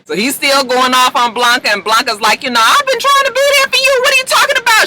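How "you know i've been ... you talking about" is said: imitated high, fast yell; retold yelling back. Blanca defends herself against Damon, mock yelling back. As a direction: yell back in a much higher, exasperated voice. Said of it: "She's yelling back at him".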